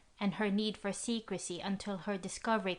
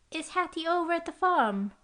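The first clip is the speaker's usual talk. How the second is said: put-on young girl voice; 9 semitones higher, 4 dB louder than usual. A narrator's reading. Girl voice, high.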